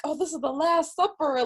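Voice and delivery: high, hyped-up group quote; excited mock drama. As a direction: play up the big final feast. Friends hype up their final feast.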